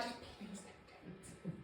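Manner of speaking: imitating Mark's soft whispered plea; mock pleading whisper. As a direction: breathe it as a hushed plea. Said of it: "he was like".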